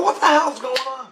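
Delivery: high-pitched retold bewilderment; bewildered demand. Husband back in his bedroom, high bewildered demand. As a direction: demand answers in a startled high voice. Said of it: "I go".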